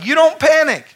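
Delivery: loud, high preached repeat of "You don't panic"; shouted repeat. Acted. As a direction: hammer the repeat home.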